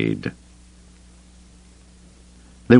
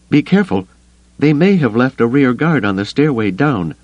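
higher, tense warning; 7 semitones higher than usual. Acted. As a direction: warn them, tense and urgent. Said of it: "Mara warned".

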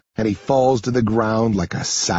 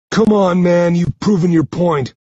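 raised, louder, urgent plea; higher-pitched exasperated objection; pleading protest; 7 semitones higher, 5 dB louder than usual. Acted. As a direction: beg off while pinned. Pinned bully pleads for release.

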